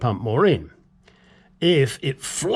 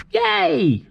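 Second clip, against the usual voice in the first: mock cheer; 12 semitones higher than usual. Mock cheer ending segment.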